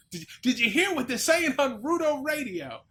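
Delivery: high, loud 1950s newsreel announcer; old-timey newspaper man. Acted. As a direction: bark it out like a vintage newsreel. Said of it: "that's my old-timey newspaper man voice".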